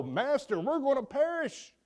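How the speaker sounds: high-pitched retold alarm; alarmed plea. Fishermen begging their master in the storm, alarmed retold cry. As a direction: raise the pitch in mock desperation.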